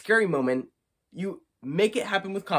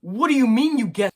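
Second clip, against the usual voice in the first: higher, louder, incredulous outrage; fed-up disbelief at the screen; real exasperation; 8 semitones higher, 8 dB louder than usual. Real, not acted. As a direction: reviewer venting anger at the scene. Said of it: "that just made me super mad".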